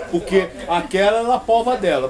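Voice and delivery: ordinary talk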